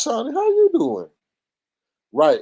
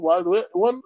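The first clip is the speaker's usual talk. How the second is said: ordinary talk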